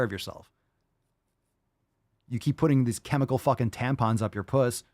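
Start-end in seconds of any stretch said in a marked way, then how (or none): none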